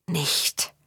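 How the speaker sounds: barely voiced, just lips forming the word; mouthed silently. A narrator's reading. Zinder silently warning Faun, mouthed. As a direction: mouth it without sound. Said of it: "formten Zinders Lippen".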